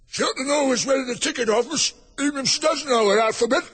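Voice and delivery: high, bleating shout; loud, high-pitched goat. A narrator's reading. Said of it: "said in a loud".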